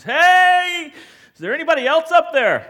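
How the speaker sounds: high, booming shout upward; huge comic yell after a big breath; yell at full lungs. Acted. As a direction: holler for someone else, with all your might. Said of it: "yells at the top of his lungs".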